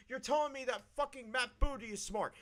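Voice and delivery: normal talking voice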